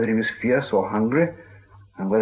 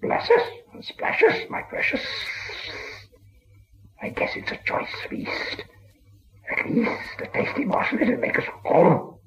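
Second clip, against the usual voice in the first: breathless hissing creature whisper; 13 semitones higher than usual. A narrator's reading. Gollum whispers and hisses at Bilbo, hungry and sly. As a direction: whisper and hiss it like a starving cave creature. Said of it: "whispered and hissed".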